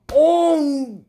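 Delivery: high, loud cry of alarm; shocked gasp. Retold or imitated. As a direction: gasp out as someone jumps in front. Shock as spell hits.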